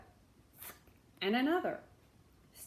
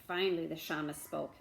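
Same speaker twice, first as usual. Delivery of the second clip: usual speaking voice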